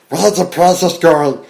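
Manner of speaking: odd voice. Retold or imitated. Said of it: "trying out his voices".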